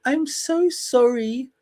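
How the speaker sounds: slow, high mock apology; put-on American voice, drawn out; hammed-up contrite plea in American accent; Meghan Markle apologizing. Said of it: "for Meghan Markle to come out and say".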